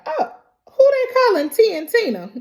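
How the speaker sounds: mother offended by her nickname, indignant; indignant Tina. Retold or imitated. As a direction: bristle at the nickname.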